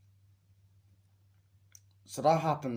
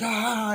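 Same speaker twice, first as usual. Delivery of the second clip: high, loud short scream; mock scream; 10 semitones higher, 7 dB louder than usual. Victim screams in the unrated version, mock scream. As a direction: let out a sudden mock scream.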